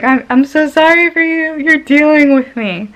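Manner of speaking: overly apologetic to her nurse; apologetic plea. Retold or imitated.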